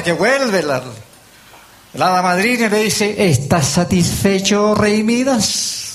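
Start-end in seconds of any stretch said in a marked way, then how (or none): 3.3-5.8 very slow, stretched-out question; fairy godmother questioning. Acted. Fairy godmother returns to ask Midas, slow and drawn out. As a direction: draw out each word, pointedly.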